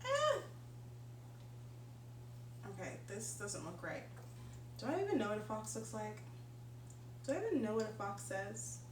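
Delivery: ordinary delivery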